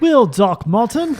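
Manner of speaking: put-on high, slow, loud English accent; drawn-out hammy English accent. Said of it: "Let's hear your English accent".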